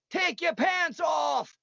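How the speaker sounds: high, slow, drawn-out mock yell; a heckler in the crowd yelling at the singer, high mock shout; imitating a heckler's yell. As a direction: bellow it like a drunk heckler in the crowd. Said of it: "yell at me the entire".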